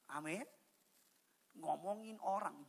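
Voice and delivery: ordinary talk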